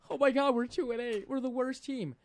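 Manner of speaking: high-pitched mock wail; mock crying fans. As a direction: whine like a distraught sports fan. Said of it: "all of them crying right now".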